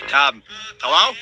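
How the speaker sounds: low, slowed hello; a deep, drawn-out um, hello; deep mock hello. Comedian to audience, a low slowed mock hello.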